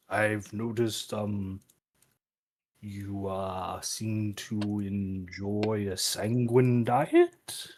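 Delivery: low, soft, very slow and careful; hushed, hesitant probing that rises at the end; character voice. Acted. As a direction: tread delicately, drawing each word out. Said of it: "turn to Garg and be like".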